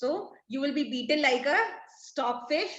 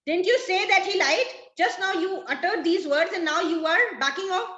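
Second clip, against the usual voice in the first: higher, louder annoyed accusation; 6 semitones higher, 3 dB louder than usual. Acted. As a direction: call him out for backing off. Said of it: "Stefano is annoyed and he says".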